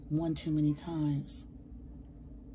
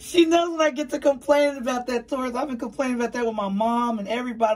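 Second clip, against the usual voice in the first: higher, louder, fast ranting; worked-up gripe, higher and louder; heated complaining; 8 semitones higher, 6 dB louder, faster than usual. Real, not acted. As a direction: rant it out fast and fired up. Complaining about price rise.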